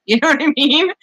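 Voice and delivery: laughing as she teases; real laugh breaking in. Real, not acted. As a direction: giggle through the question. Teasing laugh about firecracker.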